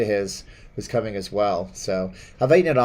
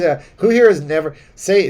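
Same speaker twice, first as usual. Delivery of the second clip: ordinary talk, nothing marked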